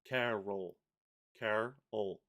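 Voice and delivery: "Carol" said in two separated parts, car, ol; split into syllables. Shown as a demonstration. Showing the morpheme rule, "Carol" split into syllables. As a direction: break "Carol" after the r, sounding the ol fully. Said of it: "it's that morpheme rule".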